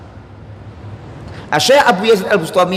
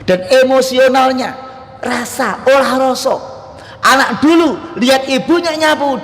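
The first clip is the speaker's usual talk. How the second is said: fiery preaching; 8 semitones higher than usual. Acted. Preacher urging parents to raise children in faith, at full force.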